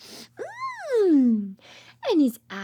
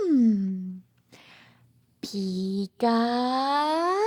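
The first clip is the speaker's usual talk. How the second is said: elephant voice; 6 semitones lower, much slower than usual. Acted. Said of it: "An elephant said".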